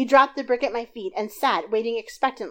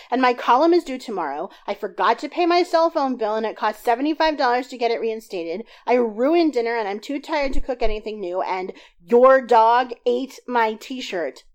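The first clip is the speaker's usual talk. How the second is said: higher, louder, a build of upset complaints; tearful litany; 4 semitones higher, 7 dB louder than usual. A narrator's reading. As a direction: pile on every grievance, near tears. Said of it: "I was in tears by the time I finished my litany".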